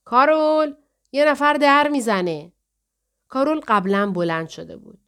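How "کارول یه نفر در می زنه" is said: loud, high call down the stairs; father yelling to reach Carol below; shouting from upstairs. A narrator's reading. Carol's father calls down from upstairs, loud shout. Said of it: "پدر کارول از طبقهٔ بالا فریاد زد".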